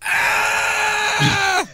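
a short high shouted "Ah!"; imitated crazy yell. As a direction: shriek it like the madman. Mimics the guy while laughing at the clip.

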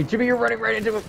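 fast, high-pitched urgent yell; alarmed warning. Real, not acted. Warning Jimmy he is running into an enemy, alarmed yell. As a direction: warn him frantically, pitch climbing.

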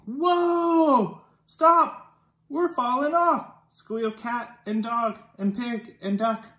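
plain reading voice

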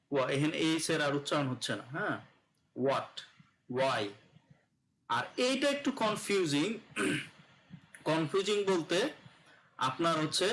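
usual speaking voice

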